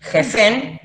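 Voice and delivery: "Jefen" with a rough throaty J, stress on the last syllable; guttural J. Shown as a demonstration. Teacher modelling the Egyptian word for hundred thousand, guttural. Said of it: "las J S es como si tuvieran babita acá en la garganta".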